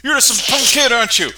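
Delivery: put-on Australian caller threatening a punk kid; threatening Aussie. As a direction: sputter the threat like a furious Aussie. Bruno threatens Bart.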